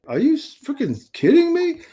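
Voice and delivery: high-pitched, incredulous outrage; retold fury. Player hearing he will be cut, retold fury. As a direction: voice leaping up in indignant protest. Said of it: "I'm furious. Like".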